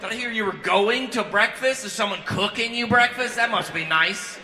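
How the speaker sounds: high, loud, drawn-out mock outrage; sarcastic envy. Friend's wife hearing of rehab drug tests, sarcastic envy. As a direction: stretch it out in loud, jealous sarcasm. Envious mother mocks rehab complaint.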